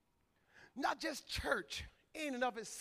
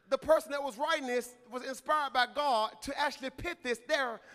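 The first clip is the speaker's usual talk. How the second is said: ordinary talk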